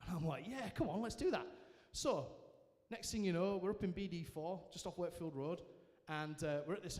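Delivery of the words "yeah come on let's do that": eager, higher-pitched yes; retold keen yes, pitched up; eager agreement. Accepting friends' invite to beers and pizza, eager.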